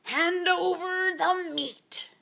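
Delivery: a hint of a pirate captain barking orders; pirate captain demanding the meat, slowly; lightly voiced pirate captain. A narrator's reading. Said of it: "Braidbeard gulped his food and said".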